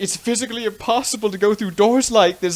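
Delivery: high-pitched cartoon protest; Fred. Retelling Fred's objection to the door gag, mock protest. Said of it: "and Fred's like".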